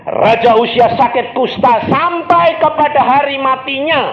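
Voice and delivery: high, loud pulpit cry; shouted scripture climax; preacher's shout. Acted. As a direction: proclaim the verse at full force.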